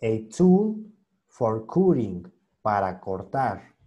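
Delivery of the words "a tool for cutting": very slow, every word separated; slow reread. Shown as a demonstration. Teacher rereads the line with translation, slow and deliberate. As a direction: lay each word down slowly. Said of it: "ya como que tiene un poquito de sentido el texto".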